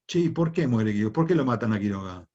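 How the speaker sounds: usual speaking voice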